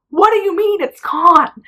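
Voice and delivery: high, loud incredulous question; retold disbelief. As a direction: push it up high, demanding an explanation. Retold shock at missing implant.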